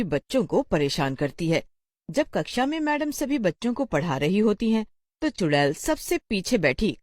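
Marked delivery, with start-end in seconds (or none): none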